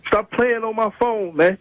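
high, slow furious telling-off; angry. Real, not acted. Husband telling off the caller over his wife, high and furious. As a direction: shut the caller down hard.

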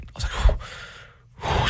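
breathless and airy; winded. Retold or imitated. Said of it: "was winded at one point".

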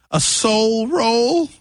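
slow, high, drawn-out disbelief; incredulous mockery. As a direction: stretch it out in mock disbelief. Mocking the dish name.

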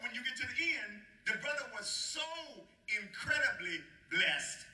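rising, ringing sermon delivery; preaching. Acted. Preacher rousing the church toward God's purpose, full preaching force. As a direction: preach it out to the congregation.

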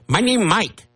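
lightly put-on Chinese accent; mock Chinese accent. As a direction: imitate a Chinese accent, mocking. Mocking the man introducing himself.

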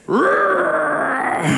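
long high roar with a fall; sustained playful growl; imitated child's roar. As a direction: roar high like a feisty little boy. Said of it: "he said".

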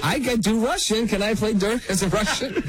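high-pitched joking request; mock eager plea. Actor retelling his jokey request to play Russian, high mock plea. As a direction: plead for it playfully, pitched well up, laughter creeping in.

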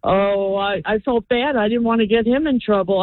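ordinary talk, nothing marked

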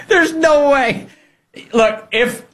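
high, slow words through a laugh; can't stop laughing at it. Real, not acted. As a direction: burst out laughing in disbelief. Laughing at the sir story.

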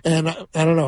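usual speaking voice

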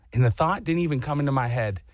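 ordinary talk

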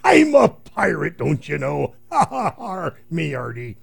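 hearty high pirate with a booming laugh. Retold or imitated. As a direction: ham up a jolly old sea dog. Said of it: "He says".

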